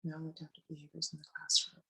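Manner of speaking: faint, hoarse near-whisper; a voice almost gone, barely voiced; losing voice. Real, not acted. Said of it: "excuse my voice, I'm losing it".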